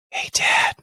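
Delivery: whispered imitation of a little daughter; hushed, breath-only kid voice; child's whisper. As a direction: whisper to dad during a movie. Little daughter whispering during the movie.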